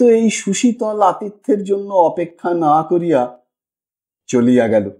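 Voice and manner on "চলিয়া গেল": ordinary delivery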